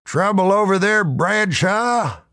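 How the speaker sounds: high, loud barkeep's yell; yelled across room. Acted. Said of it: "the barkeep yell across the room".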